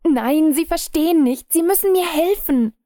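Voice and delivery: viel höher und lauter, verzweifelt; verzweifeltes Flehen. A narrator's reading. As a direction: sie anflehen, ihm zu helfen. Verängstigter Mann fleht. (verzweifelt, hoch und laut)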